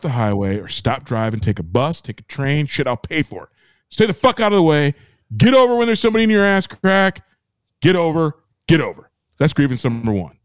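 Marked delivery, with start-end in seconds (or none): none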